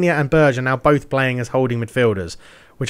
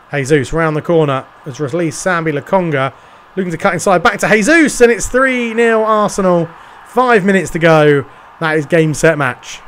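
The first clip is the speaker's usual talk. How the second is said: rising to a loud, higher goal shout; excited goal call; 4 semitones higher, 6 dB louder than usual. Acted. Commentator calling Arsenal's third goal, loud and excited. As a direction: build the call up and explode on the goal.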